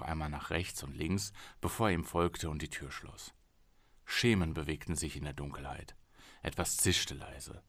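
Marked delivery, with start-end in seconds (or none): none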